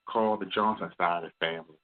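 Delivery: ordinary talk, nothing marked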